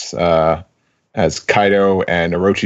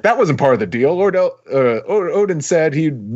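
panicked pleading; 8 semitones higher than usual. Retold or imitated. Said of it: "He says, like".

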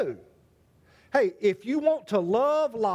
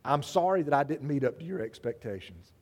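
calm gentle reply; 6 semitones lower, 4 dB quieter than usual. Retold or imitated. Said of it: "He said".

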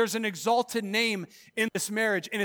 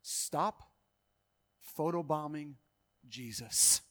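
quoted saying; 7 semitones lower, 10 dB quieter, slower than usual. Retold or imitated. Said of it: "He said it like this".

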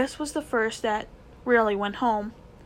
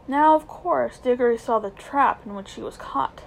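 ordinary delivery

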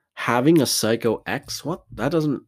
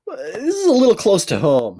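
embarrassed exclamation; 10 semitones higher, 6 dB louder than usual. Real, not acted.

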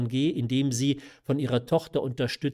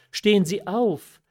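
raised, louder imploring command; higher, pressing exclamation; urgent call; 7 semitones higher, 6 dB louder than usual. A narrator's reading. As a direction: call out insistently to rise. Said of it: "rief die Marquise".

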